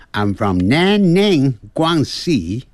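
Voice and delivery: Chinese place names in a foreigner's accent; foreign accent. Shown as a demonstration. Teacher mimics how foreigners say 南宁，广西, foreign accent. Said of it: "如果要学这个外国腔".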